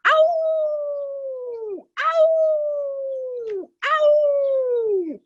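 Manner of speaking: long high howls; wolf pack howling together. Acted.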